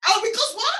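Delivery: a loud, high burst of disbelief; real outrage at what she heard; shocked. Real, not acted. Host reacts to client's lowball demand.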